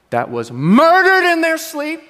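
milk the shocking news; mock dramatic wife. Wife telling husband about a murdered family in bed, dramatic and loud. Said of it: "she'll go".

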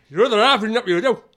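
hammed-up northern boss line; northern accent again. Boss repeating the line to the wife, fast loud northern accent.

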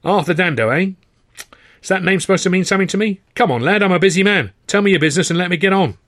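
brisk, testy man with no time to spare; harassed busy manager. Acted. Harassed manager questions young stranger, impatient.

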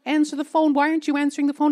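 will the line to pick up, exasperated; frantic inner call to London, half-voiced; urgent pleading. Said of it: "calling from Kyiv thinking".

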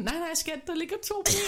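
high, cheerful imitation of husband. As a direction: chirp it like a helpful husband. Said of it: "han er sådan".

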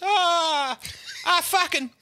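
high-pitched hammed-up wail; mock-sob the complaint in a very high voice; comically wailing, aggrieved; crying. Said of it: "[name]'s fucking crying".

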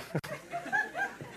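high squealing laugh. Real, not acted. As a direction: burst into a high giggle. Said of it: "Told you".

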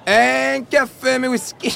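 high, drawn-out fairytale order call; sagoröst. Acted. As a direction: sing the order out like a storybook innkeeper. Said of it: "när jag gjorde den där rösten". (hög, utdragen sagoröst)

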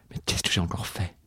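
unvoiced whispered aside. A narrator's reading. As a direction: whisper it, put upon. Inner aside of heroine.

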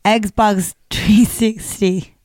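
slow, exaggerated Nigerian accent; mock Nigerian accent. Shown as a demonstration. Host demonstrating accent hack, slow mock Nigerian accent. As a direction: drag it out in a put-on Nigerian accent. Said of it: "this is the Nigerian".

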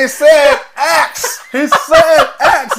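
very slow, high and loud, through laughter; laughing loudly while insisting to grandma; laughing insistence. Retold or imitated. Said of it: "I said".

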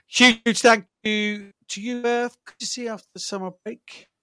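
warm thanks. Real, not acted. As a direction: let the gratitude stretch out. Thanking co-host warmly.

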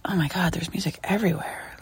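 whispered, worn-out groan; weary whisper of a tired tourist; exhausted whisper. Retold or imitated.

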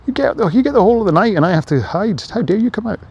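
high, loud, outraged voice; indignant sun. Pretend. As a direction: scold the moon like an offended diva. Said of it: "And the sun's like".

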